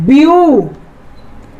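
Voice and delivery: Beautiful slowly, long view then a pause; split into syllables. Shown as a demonstration. Teacher telling students to try saying it, split into syllables. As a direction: stretch beautiful out part by part. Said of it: "उच्चारण करके आपको भी देखना होगा".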